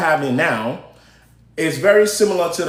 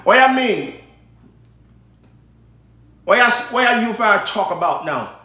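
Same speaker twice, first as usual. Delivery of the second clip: put-on Jamaican accent; 2 semitones higher than usual. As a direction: toss it off in a Jamaican lilt. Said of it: "shout out to my Jamaicans".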